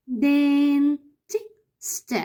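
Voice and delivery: دين، تي، ست with a pause between each; slow sounding out; syllable by syllable. Shown as a demonstration. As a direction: chop the word into three beats. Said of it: "يبقى أنا هقول دين".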